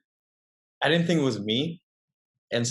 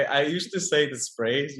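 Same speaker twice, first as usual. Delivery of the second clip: usual speaking voice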